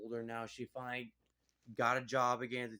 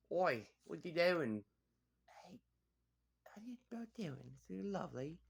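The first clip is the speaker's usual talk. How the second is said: put-on British accent; 5 semitones higher than usual. Reviewer mimics the husband's odd British accent. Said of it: "He has a weird British accent".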